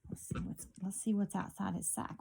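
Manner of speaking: hushed suspense whisper; breathless storytime whisper. Real, not acted.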